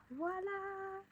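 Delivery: drawn-out sing-song reveal, rising high; triumphant reveal. Real, not acted. Finishes putting it through, sing-song ta-da voice. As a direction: stretch it out and lift the last word.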